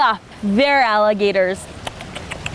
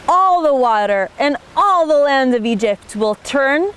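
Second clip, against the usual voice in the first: ordinary delivery, nothing marked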